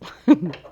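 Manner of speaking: quick embarrassed giggle; a little burst of laughter; short laugh. Real, not acted. Laughs at her own hiccups.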